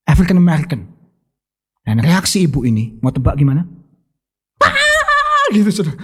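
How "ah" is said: much higher, laughing mock yelp; mock scream of fright. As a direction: squeal it like a startled lady, half laughing. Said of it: "langsung teriak".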